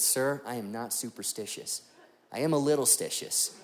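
soft, deadpan sitcom boss; a lightly voiced quoted line, said low and hushed; Michael Scott. Pastor quoting a sitcom boss to the tire rep, lightly voiced. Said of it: "that's Michael Scott quote number five".

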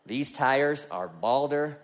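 a hint of a Texan salesman drawling; Texas drawl. Pastor imitating the Texan tire rep, in a lightly Texan voice. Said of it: "eh, he's Texas".

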